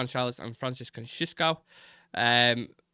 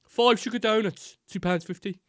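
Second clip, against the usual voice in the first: put-on Brummie accent; 8 semitones higher, 4 dB louder than usual. Donut seller repeating himself to a baffled customer, strong Brummie accent. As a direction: repeat it in thick Birmingham tones. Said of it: "like, the strongest Brummie accent ever".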